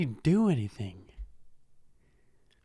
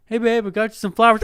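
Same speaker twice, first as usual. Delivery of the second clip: mock romantic boyfriend; 6 semitones higher, 8 dB louder, much faster than usual. Boyfriend acting out romantic gift.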